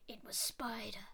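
hushed narration as Spider creeps in; whisper. A narrator's reading. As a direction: drop to a spooky whisper. Sinister spider enters.

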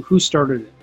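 ordinary delivery

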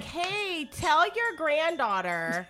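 high, slow mock-sweet greeting; petty comeback. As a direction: pretend to greet the neighbour with a pointed dig. Rehearsing a petty comeback to neighbour.

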